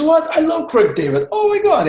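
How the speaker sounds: high-pitched, gushing imitation of a thrilled fan; excited mock fan outburst; starstruck disbelief. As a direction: mimic an amazed salesman, pitch leaping way up. Retold salesman's amazed reaction.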